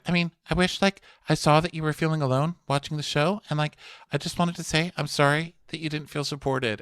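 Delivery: high, prissy mock apology; a put-on sugary reality-star voice; imitating Stephanie. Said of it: "So Stephanie's like".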